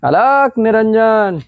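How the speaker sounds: slow, high chanting beggar call; sadhu begging call. Acted. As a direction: drawl it out like a wandering sadhu. Baba begging at the door.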